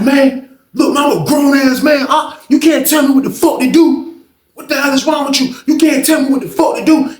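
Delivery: retold as a furious comeback; angry shout. Heated quarrel retold.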